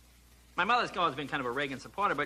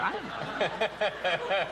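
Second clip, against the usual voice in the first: feigned innocence; 3 semitones higher, much slower than usual. Retold or imitated. Son playing innocent to dad.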